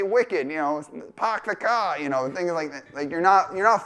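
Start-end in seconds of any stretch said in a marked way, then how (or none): 0.0-0.5 hammed-up Boston slang, higher and louder; playful, pitched-up fake Boston; fake Boston accent. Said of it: "tried to fake a Boston accent". 1.2-2.0 pahk-the-cah Boston, pitched way up; hammed-up, high-pitched Boston accent; fake Boston accent. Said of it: "tried to fake a Boston accent".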